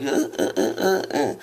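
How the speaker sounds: mock startled stammering, partly breathless; flustered stammer. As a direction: imitate her caught off guard, stuttering. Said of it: "She got all wide-eyed".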